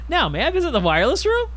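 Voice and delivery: high loud nervous laughter; awkward laugh. Real, not acted. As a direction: cringe at your own question. Awkward line, then laughter.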